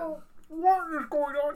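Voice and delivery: high, slow, drawn-out cartoonish accent; Kermit the Frog accent. Podcaster mimics the actor's odd accent for friends, high Kermit-like voice. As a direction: put on a weird, high accent, stretching it out. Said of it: "It's weird, like Kermit the Frog".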